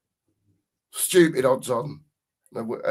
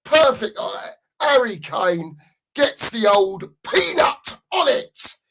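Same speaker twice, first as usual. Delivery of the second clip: mock commentary; 7 semitones higher, 4 dB louder than usual.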